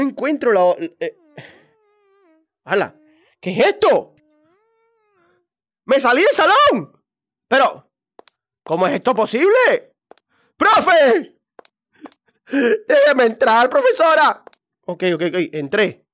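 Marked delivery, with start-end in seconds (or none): 2.6-9.9 amazed he got out of the room; surprised. Real, not acted. Gamer startled at escaping the classroom, high amazed cry. 10.6-14.4 high pleading shout; calling out. Real, not acted. Player locked outside begging the teacher, loud pleading call.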